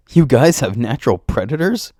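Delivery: high, loud astonishment; amazed shout. A narrator's reading. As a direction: blurt it out in disbelief. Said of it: "I shout, amazed".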